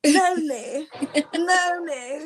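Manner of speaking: lightly posh, drawn-out name call. Pretend. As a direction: say her name with prestige. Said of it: "I get into my posh voice when I have to call your name".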